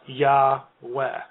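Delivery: Yahweh drawn out slowly as YAH-weh; pronunciation demo. Shown as a demonstration. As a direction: split Yahweh into two slow syllables. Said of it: "when other people refer to God".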